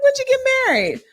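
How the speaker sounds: high, loud, laughing question; mock surprise. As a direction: act stunned in a high laughing voice. Repeats the retold question.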